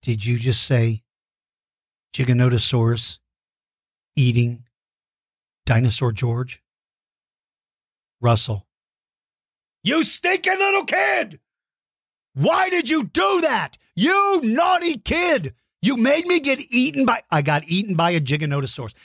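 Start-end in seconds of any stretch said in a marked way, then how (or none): 0.0-8.7 mock dread. Slow ominous mock disbelief. 9.8-17.2 hammed-up shout, much higher; fake-furious yell at the kid; mock outrage. Host mock-yelling at Russell for getting him eaten.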